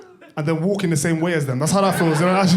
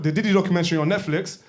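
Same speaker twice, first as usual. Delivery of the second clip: usual speaking voice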